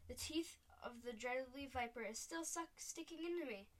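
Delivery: ordinary delivery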